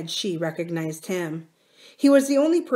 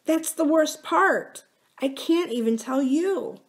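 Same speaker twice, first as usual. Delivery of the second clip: much higher, plaintive confession; mildly distressed; 9 semitones higher than usual. Acted. Wife hiding a secret from her husband, mildly distressed. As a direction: hold back the secret, pitched high.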